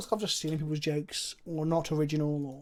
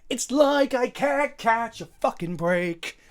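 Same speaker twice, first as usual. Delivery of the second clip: frustrated; 5 semitones higher, 7 dB louder, slower than usual. Real, not acted. Frustrated at bad pack.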